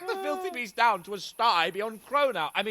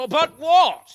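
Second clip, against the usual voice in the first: annoyed outburst; 6 semitones higher, 10 dB louder, slower than usual. Acted. Drunk noble interrupts a servant.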